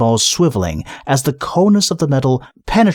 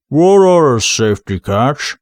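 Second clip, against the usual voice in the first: troll rumble; 3 dB louder than usual. Acted. Detritus troll voice.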